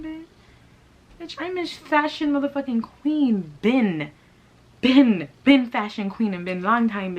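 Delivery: high, loud diva tirade in a put-on voice, hammed up; sassy diva rant. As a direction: tear into her like a self-proclaimed fashion queen. Retort to Twiz in diva voice.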